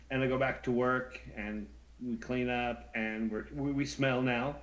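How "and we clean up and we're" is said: ordinary talk, nothing marked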